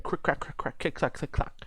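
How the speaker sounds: high, half-whispered crick crack; bone snap sounds. Retold or imitated. Recapping the healer breaking her fingers again, high clicks. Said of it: "starts cracking it again".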